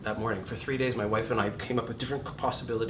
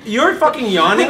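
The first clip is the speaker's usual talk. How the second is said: high, loud, laughing disbelief; incredulous at a yawning student; high loud laughing rant; mock outrage; 11 semitones higher, 14 dB louder than usual. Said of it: "I'm like".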